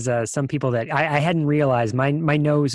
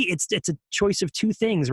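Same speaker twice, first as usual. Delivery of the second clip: usual speaking voice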